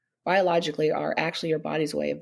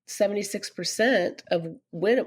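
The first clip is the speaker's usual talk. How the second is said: ordinary delivery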